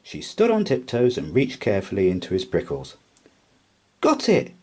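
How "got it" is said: high, loud little cheer. A narrator's reading. Mouse cheers pulling the nut out, high and loud. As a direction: cheer the catch. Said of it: "she cheered".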